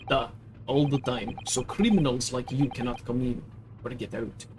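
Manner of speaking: put-on broken English in a heavy Russian accent; proud Russian crewman correcting the girl; gruff Russian sailor. Sailor to the maid, Russian accent, offended. Sailor boasts of his watch.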